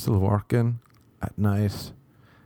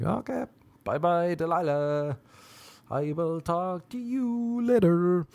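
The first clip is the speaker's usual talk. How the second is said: sing-song brush-off, pitched up; mock-sweet goodbye; 7 semitones higher than usual. Turned-off man bidding the girl bye, mock-sweet high voice.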